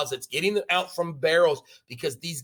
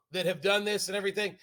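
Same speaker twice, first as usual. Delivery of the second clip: usual speaking voice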